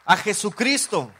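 preacher's ringing cry of "A Jesucristo"; emphatic proclamation. Acted. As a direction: draw out "A Jesucristo" with force. Repeats name, first emphatic.

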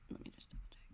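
unvoiced whispered aside. Real, not acted. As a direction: whisper it to yourself. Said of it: "muttered aside while checking".

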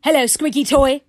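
yelled toy playback; grumpy yelling toy. Acted. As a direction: bark it back like a grumpy toy. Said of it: "grumpy and, oh dear, in a yelling".

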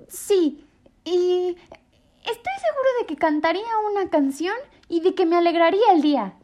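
high small-bird voice, hopeful; a tiny bird telling what mama would do; little bird voice. A narrator's reading. Choco answers Mrs Bear.

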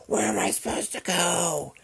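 very high mock screech; shrieky, put-on stranger's voice; crazed witchy lady. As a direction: imitate a gremlin-like woman wailing back. Crazed lady's retort, acted out.

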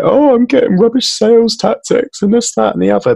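high-pitched put-on moan; exaggerated complainer's voice, starting very high; mock complaining. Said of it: "sit on my high horse and complain".

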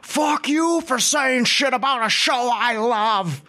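high, slow, drawn-out outraged yell; Jimmy Stewart. Retold or imitated. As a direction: yell it like an old-time film star. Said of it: "he said it like he was fucking Jimmy Stewart".

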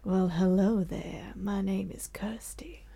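seductive serial-killer hush; lady criminal voice. Acted. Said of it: "you being a lady criminal".

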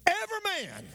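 high-pitched preacher's cry; impassioned pulpit cry; full-force preaching. Real, not acted. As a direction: lift it way up high, preaching hard.

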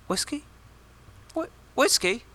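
put-on high, questioning Canadian-accent "whiskey?"; a lightly put-on Canadian accent on "whiskey". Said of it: "how can you do a Canadian accent and say whiskey".

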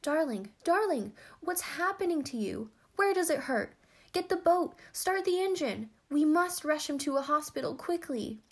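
high, loud, panicked pleas; frantic crying out. A narrator's reading. Ancient half-sister to her stricken lover, frantic cries. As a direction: cry out in alarm for urgent help.

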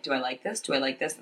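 quiet, hushed inner thought. Retold or imitated. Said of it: "And I'm in my head".

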